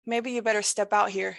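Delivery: usual speaking voice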